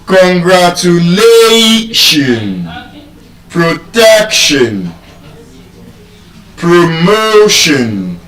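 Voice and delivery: slow "Congratulation, protection, promotion", holding LA, TEC, MO; penultimate stress. Shown as a demonstration. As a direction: stretch the second-to-last syllable in "Congratulation, protection, promotion". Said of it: "the stress is on the second to the last syllable".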